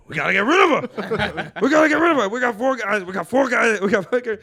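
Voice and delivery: building from talk into a loud, high, laughing rant; Joey Diaz riffing. Retold or imitated. Retelling Joey Diaz roasting an open mic crowd, loud laughing rant. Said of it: "That's a good impression".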